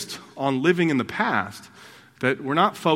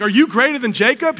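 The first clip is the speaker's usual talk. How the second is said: incredulous; 6 semitones higher, 6 dB louder than usual. Retold or imitated. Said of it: "she's like".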